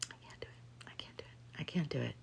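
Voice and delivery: breathy whispered repetition. Real, not acted. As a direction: whisper it to yourself, defeated. Self-talk trailing into whisper.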